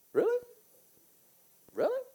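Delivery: incredulous, pitching way up; mock disbelief. Real, not acted. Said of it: "mocking disbelief".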